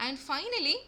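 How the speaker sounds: ordinary talk, nothing marked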